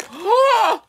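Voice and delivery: loud, high startled gasp; sharp gasp. Real, not acted. Child spots the puppy chewing bubbles, gasping loudly. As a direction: gasp in shock at what the dog did.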